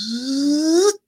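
high mechanical "zzz" buzz; buzzing hum. Retold or imitated. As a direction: hum like a motor lifting. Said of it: "it goes".

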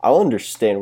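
ordinary delivery

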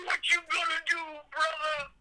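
very high, strained wrestler hype; hammed-up wrestling promo challenge; wrestler catchphrase. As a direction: call it out like a high-pitched wrestling showman.

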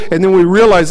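ordinary talk, nothing marked

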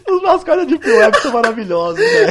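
high-pitched helpless laughter through words; crying with laughter; laughing hard. Real, not acted. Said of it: "eu tô dando risada".

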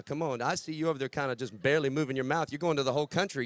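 usual speaking voice

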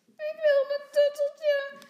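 put-on very high, loud toddler wail; crying little boy. A narrator's reading. Tommy demands his comforter from mama, crying. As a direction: wail like a small child in tears. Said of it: "zegt Tommy huilend".

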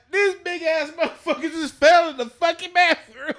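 shouted high and loud to the whole group; mocking public shout; loud announcement. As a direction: yell it out to everyone. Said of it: "I was like".

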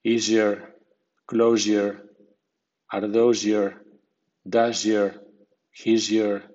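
"does your" said as "dozhyour"; zh blend. Shown as a demonstration. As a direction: run voiced s and y together into zh. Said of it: "S sonora más I suena z".

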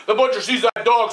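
usual speaking voice

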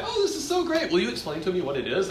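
high, sugary gushing over a scribble; gushing praise to child. Pretend. Teacher mimics adult gushing to a child, high sugary praise. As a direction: coo over the drawing like a doting adult.

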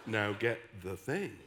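low, slow minister's voice; imitated solemn pronouncement, pitched low; woman minister. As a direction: intone it weightily, phrase by phrase. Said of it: "woman minister, came up to me".

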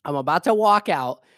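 ordinary talk

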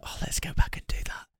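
unvoiced whispered aside. Real, not acted.

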